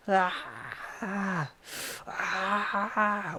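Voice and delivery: high mock screaming ah; retold scream. Attacker twitching in cuffs, screaming in a high mock voice. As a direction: imitate a twitching man crying out in high-pitched ahs. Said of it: "he starts screaming".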